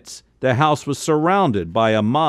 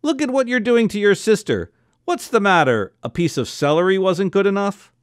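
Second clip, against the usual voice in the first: yelling; 7 semitones higher than usual. A narrator's reading. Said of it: "Esther yelled at Izzy".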